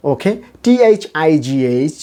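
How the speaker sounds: ordinary delivery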